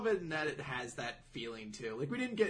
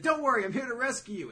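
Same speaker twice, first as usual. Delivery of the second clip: rescuer; 8 semitones higher, 4 dB louder than usual. Retold or imitated. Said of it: "and he's like".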